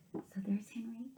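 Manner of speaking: whispered with quiet wonder; hushed whisper. Real, not acted. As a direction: breathe it out in awe.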